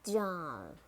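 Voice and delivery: "jar" ending in an American R; American "jar", curl the final R; American accent. Shown as a demonstration. Teacher models jar for learners, American accent. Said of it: "R in American English".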